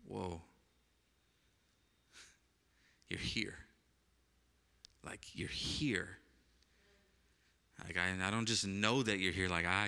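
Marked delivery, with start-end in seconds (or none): 0.1-6.2 soft, near-whispered wonder; hushed awe. Real, not acted. Pastor reliving lying on the carpet, speaking to God: soft, awed near-whisper. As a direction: breathe it out quietly, amazed.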